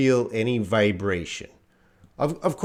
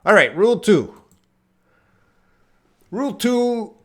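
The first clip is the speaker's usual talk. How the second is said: excited announcement; 10 semitones higher, 6 dB louder than usual. Real, not acted. Teacher announcing rule.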